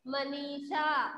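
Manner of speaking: Manisha read slowly with a falling end; slowed-down Manisha. Shown as a demonstration. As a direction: say Manisha slowly and let it fall. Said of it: "क्या बोलेंगे?".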